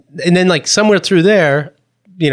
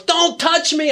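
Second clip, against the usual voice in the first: a contained, high mimicked yell; imitated yell; 11 semitones higher, 3 dB quieter, slower than usual. Another person in the session yells, high mock yell. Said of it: "Another person yells".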